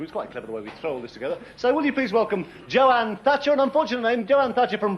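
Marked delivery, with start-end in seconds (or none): 0.0-1.4 fast, much softer aside; quick muttered aside. Real, not acted. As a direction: mutter it under your breath, quickly. Self-mocking aside.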